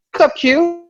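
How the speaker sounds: high-pitched mock friendly hello; friendly greeting. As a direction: imitate a pal's high-voiced greeting. Friendly greeting in retold story.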